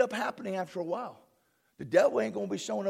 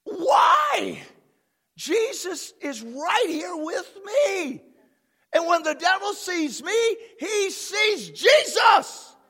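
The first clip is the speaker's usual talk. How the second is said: tearful full-force preaching; 7 semitones higher, slower than usual. Acted. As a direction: cry it out in triumph.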